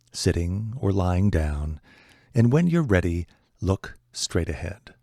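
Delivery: calm, unhurried meditation voice with long pauses; guided meditation. Acted. Teacher guiding listeners to relax, calm meditation voice.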